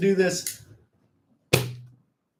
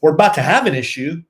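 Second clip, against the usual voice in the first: warning; 10 dB louder, faster than usual. Real, not acted. Bickering with co-host, threatening.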